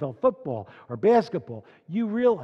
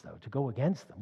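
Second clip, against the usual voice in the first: ordinary delivery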